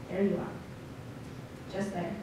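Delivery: quiet, suspended poem line; hushed. Acted. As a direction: let the voice drop away almost to nothing. Poem reaches a frozen moment.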